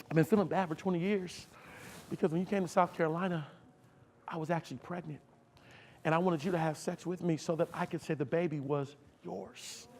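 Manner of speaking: soft, hushed retelling of a confession; subdued tearful confession. Retold or imitated. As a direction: imitate her quietly, with understated sorrow. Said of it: "she's bawling in tears".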